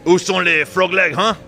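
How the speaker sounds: hammed-up French accent with a haughty laugh; mock French accent. Honoring the Normans as William the Conqueror, mock French accent. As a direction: put on a silly Frenchman's voice. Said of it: "I don't know what kind of accent he had, but in honor of the Normands".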